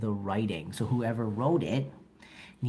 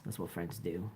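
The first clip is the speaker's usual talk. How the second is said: teasing whisper; 5 semitones lower, 11 dB quieter than usual. Real, not acted. Joking aside after teasing.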